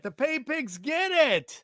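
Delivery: high, slow goofy voice; put-on high voice. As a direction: ham it up in a silly voice. Repeats catchphrase, put-on voice.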